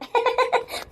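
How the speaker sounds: high, soft giggle at her own hairdo; real laugh. Real, not acted. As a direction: laugh at the silly flower in your hair. (தன் தலைப்பூவைப் பார்த்து சிரிப்பு)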